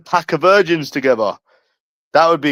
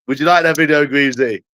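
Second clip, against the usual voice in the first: usual speaking voice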